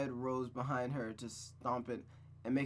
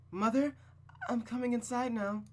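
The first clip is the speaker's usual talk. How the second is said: put-on high, raised girl's voice calling; calling into the house; 10 semitones higher, 3 dB louder than usual. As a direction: call out into an empty house. Rose calls before entering.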